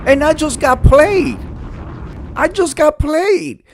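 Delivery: high-pitched, drawn-out indignation; worked-up, high comic outrage; outraged yell at husband. Retold or imitated. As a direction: wail it in rising disbelief. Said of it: "I yelled at my husband".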